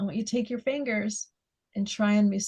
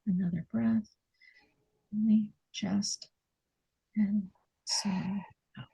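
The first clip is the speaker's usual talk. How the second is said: quiet breathing coach; guided breathing; 5 dB quieter than usual. Acted. Guiding breathing, softer.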